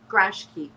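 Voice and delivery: "Grashki", garage key squashed to two syllables; "Grashki" run together as one word; Chicago slurred compound. Shown as a demonstration. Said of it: "stick to open your garage".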